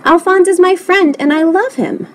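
high-pitched heartfelt protest; earnest cry. A narrator's reading. Lewis declares love for his pet, crying out. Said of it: "cried Lewis".

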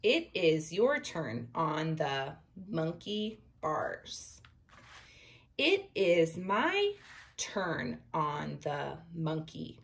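slow, careful read-aloud; reading to children. Acted. Teacher reading the picture book aloud to her class, slow. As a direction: read it slowly for young readers.

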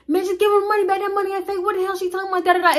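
high, mock-outraged group voice; crowd of dudes. Customer's friends protest to the manager, high mock outrage. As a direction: yell like his buddies backing him. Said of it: "They was like".

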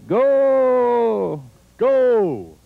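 drawn-out soccer goal call; long goal yell. Shown as a demonstration. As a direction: stretch it like a commentator. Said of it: "cómo se grita un gol".

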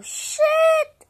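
loud, high startled shit; shocked high yelp. Real, not acted. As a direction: cry out in sudden alarm. Sudden alarm.

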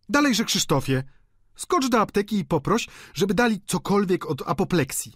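high, urgent woman's voice; nagląca gospodyni. A narrator's reading. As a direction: hurry the servant along, pitched high. Gospodyni wydaje polecenia służącemu. (wysokie, podniesione polecenie gospodyni)

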